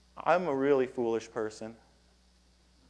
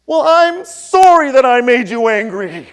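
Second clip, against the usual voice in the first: mock apology; 9 semitones higher, 13 dB louder than usual. Woman answers sarcastically.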